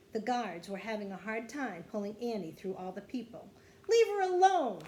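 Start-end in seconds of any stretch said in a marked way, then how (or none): 3.9-4.8 high, very loud demand; protective yell. A narrator's reading. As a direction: yell it to defend someone. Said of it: "yelled Jack".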